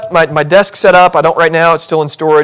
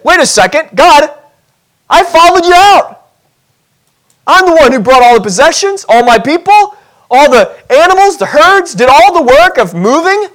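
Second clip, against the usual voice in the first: much higher, louder indignant complaint; indignant Abram; 8 semitones higher, 3 dB louder than usual. Pretend. Pastor as Abram, complaining to God about moving, high and indignant. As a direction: huff like the one who did all the work. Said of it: "could not have Abram said".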